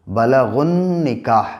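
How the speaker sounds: slow बलगुन निकाह, with the ग joined straight to the न; joined recitation. Shown as a demonstration. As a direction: run ग into न as you read slowly. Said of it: "गैन को नून से मिला देंगे". (धीमी तिलावत का नमूना)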